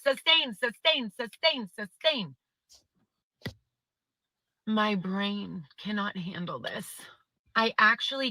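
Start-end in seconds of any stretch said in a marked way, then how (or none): 0.0-2.4 mocking repeated ruling. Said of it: "all I hear is".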